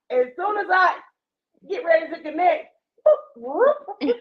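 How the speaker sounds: siren imitation. Imitating siren mid-story.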